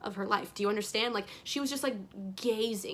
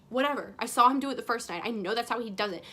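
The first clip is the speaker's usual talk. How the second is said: ordinary talk, nothing marked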